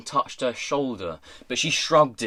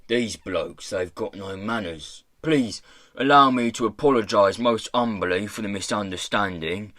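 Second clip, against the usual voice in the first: rough speaker putting on fine manners; pirate boss, humbly courteous; 3 semitones lower than usual. Acted. Pirate boss inviting a gentleman to tea, ingratiating. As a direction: welcome him with elaborate humility.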